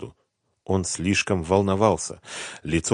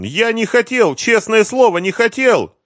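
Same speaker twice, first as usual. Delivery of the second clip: high, loud wail of fear; desperate, terrified wail; moaning plea; 9 dB louder than usual. A narrator's reading. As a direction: beg for mercy in a high moan. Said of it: "простонал он".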